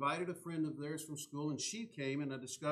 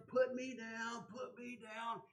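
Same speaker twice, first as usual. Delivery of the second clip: high, whiny tantrum cry; screaming toddler; 9 semitones higher, slower than usual. Retold or imitated. Pastor voicing a two-year-old fighting her dad, high whiny tantrum.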